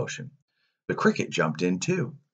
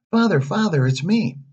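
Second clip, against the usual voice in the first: joyful reunion; 11 semitones higher than usual. Acted. Pinocchio finds Geppetto.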